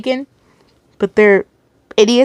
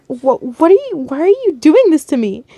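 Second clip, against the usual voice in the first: higher, stammering questions; shaken pleading; 5 semitones higher than usual. A narrator's reading. Captive asking the captor why, distressed. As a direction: plead for a reason, stumbling over words. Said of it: "starting to softly shake".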